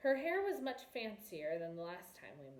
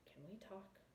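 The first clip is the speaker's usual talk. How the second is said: hushed, much softer request; whispered; 15 dB quieter than usual. A narrator's reading. Prince whispering to the princess amid watching eyes. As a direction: drop to a near whisper so others cannot hear. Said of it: "I moved closer to her and whispered".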